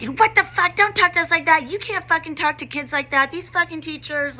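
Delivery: high-pitched indignant mock student; imitated angry outburst. Students snapping back at a teacher, imitated indignation. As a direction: act out kids' outraged retort, voice way up.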